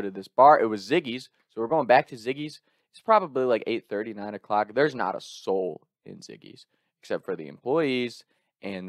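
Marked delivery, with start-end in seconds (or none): none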